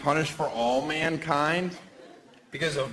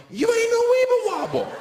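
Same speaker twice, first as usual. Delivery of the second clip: much higher, loud yelled taunt; 6 dB louder than usual. Retold or imitated. Comic yelling a taunt at a fallen girlfriend, loud and high. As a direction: scream the taunt out. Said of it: "where I can yell".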